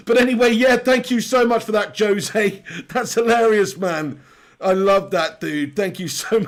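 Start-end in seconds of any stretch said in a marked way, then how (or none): none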